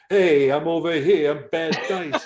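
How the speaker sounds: loud, slow, high comic brag; hammed-up Dice impression; bad Dice impersonation. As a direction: draw it out like a cocky stand-up. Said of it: "bad impersonations and I'll get stuck in it".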